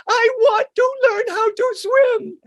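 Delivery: high, loud, crying plea; a scared little kid begging her mother; pleading child. Retold or imitated. Child pleading with her mother to learn to swim, crying. Frightened child now begs.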